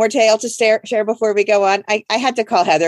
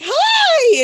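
a high, eager hi; bright greeting. Retold or imitated. Greeting the maid she thinks is her gift, high and eager. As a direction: greet her like a long-awaited guest. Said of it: "I was like".

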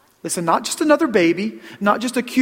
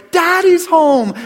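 high, drawn-out joyful call; gleeful child shouting hello; excited kids' greeting; 11 semitones higher, 7 dB louder, slower than usual. Retold or imitated. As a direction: call out like a kid running to the door. Kids greeting dad at the door.

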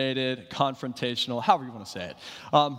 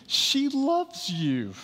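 mock tender plea; 12 semitones higher, much slower than usual.

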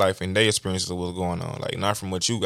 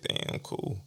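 whispered aside; 11 dB quieter than usual. Retold or imitated. Mock muted reaction to small things.

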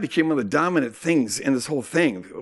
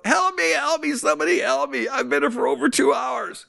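higher, imitating a desperate patient calling out; mock hollered plea; 9 semitones higher than usual. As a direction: wail it like a man begging for help in the ER. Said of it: "this guy behind me hollers out".

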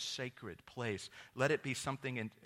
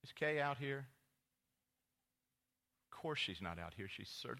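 ordinary delivery

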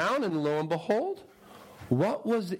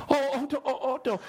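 mock wailing; 10 semitones higher, faster than usual. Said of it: "villagers pleading".

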